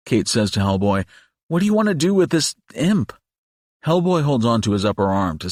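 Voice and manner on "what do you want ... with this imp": high, lightly voiced questioning woman. A narrator's reading. Kate asking Hellboy what to do with the imp, pitched up. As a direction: ask him what's next, pitched up.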